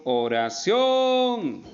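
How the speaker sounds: "oración" with "ción" stretched high and loud; stress on last syllable. Shown as a demonstration. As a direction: hold and push the final "ción" of "oración". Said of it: "que sería ción". ("oración" con "ción" alargado, agudo y fuerte)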